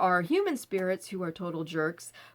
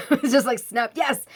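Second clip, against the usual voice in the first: laughing; 3 semitones higher, 8 dB louder than usual. Real, not acted. Laughing about relief.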